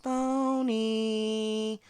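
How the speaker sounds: high, drawn-out jeer; mocking call-out. Real, not acted. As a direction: stretch the word into a taunt. Calling someone out as fake.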